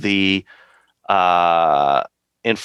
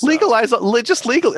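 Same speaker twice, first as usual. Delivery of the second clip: rally cry. Acted. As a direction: shout it like a protest slogan. Repeated slogan, mock rally cry.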